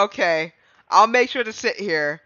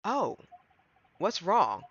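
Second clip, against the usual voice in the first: ordinary talk, nothing marked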